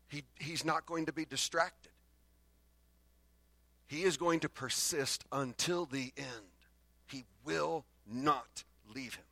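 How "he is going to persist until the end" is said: normal talking voice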